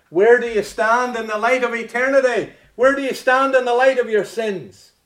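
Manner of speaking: loud, high pleading challenge; fervent preaching. Acted. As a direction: press the question hard on them. Preaching at full force.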